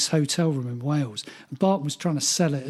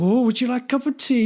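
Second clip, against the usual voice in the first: a light mock Welsh lilt, pitched up; pitched-up imitation of a Welsh hostess; Welsh accent; 7 semitones higher than usual. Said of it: "In a Welsh accent".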